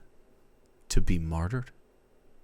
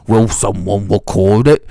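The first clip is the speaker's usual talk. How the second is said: louder, hammed-up Australian accent; Australian twang, loud and showy; mock Australian; 14 dB louder than usual. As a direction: play a theatrical Aussie. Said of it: "that's what Australians sound like".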